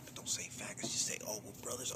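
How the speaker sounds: hushed, breathless whisper of plotting voices. Retold or imitated. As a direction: whisper it like scheming voices in her head. Imagined voices coaching each other.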